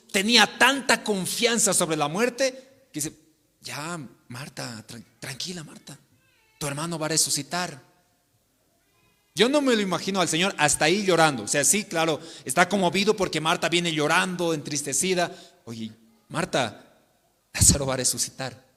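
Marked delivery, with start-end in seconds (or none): 3.6-7.8 calm a grieving friend gently; gentle comfort. Acted. Jesus voiced comforting Marta. 15.7-18.7 gentle comfort. Acted. Jesus voiced comforting Marta again.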